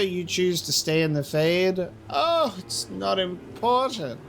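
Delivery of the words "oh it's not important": slow, high, dismissive mock voice. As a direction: brush it off in a mocking tone. Mocking the game's dismissive stance.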